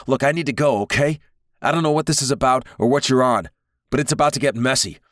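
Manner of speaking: high, loud, frantic street guy; panicked Wildborn. Acted. Wildborn urging to flee.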